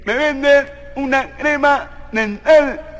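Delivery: slow, halting nasal request; nasal-voiced man. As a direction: ask through your nose, word by word. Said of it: "el gangocito viene y le dice".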